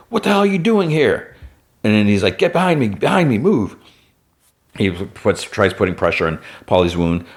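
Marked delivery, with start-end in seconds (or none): 0.1-1.3 very high-pitched mock yell; alarmed scolding, imitated with little extra volume; retold yell. Leroy yells at the kids, high mock yell. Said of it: "he yells at kids". 2.4-3.8 high, urgent retold command; urgent order. Leroy orders the kids behind him, imitated urgency.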